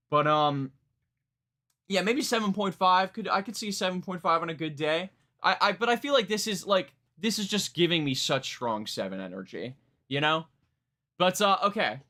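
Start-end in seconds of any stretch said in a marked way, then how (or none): none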